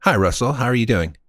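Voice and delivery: usual speaking voice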